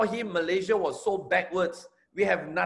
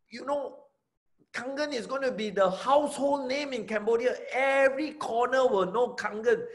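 higher, fired-up pitch sustained through the whole pitch; rock concert hype; 5 semitones higher than usual. Shown as a demonstration. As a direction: sell the vision like a rock concert. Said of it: "I was talking things like this".

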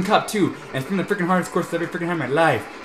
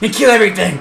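calm façade snaps into a louder, higher yell; mock rage burst; 5 semitones higher, 8 dB louder than usual. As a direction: break the calm act with a gleeful battle cry. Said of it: "Okay, back to normal".

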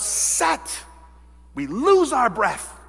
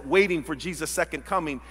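ordinary talk

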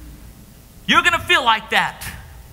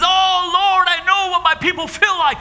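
anguished prayer; 4 semitones higher than usual. Acted. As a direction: plead with God in anguish. Jesus's anguished prayer to God.

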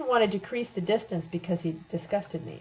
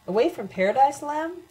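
louder, mocking question rising high on Lem; sarcastic; 7 semitones higher, 4 dB louder than usual. A narrator's reading. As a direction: needle him about his so-called paradise. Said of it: "Louisa asked sarcastically".

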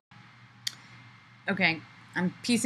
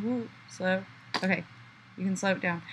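ordinary delivery

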